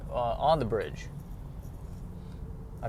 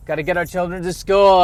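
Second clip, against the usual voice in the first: hammed-up panicky parent, pushed louder; mock a rushing parent, more urgent; frantic parent, louder; 5 semitones higher, 7 dB louder than usual. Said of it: "voicing frantic parents".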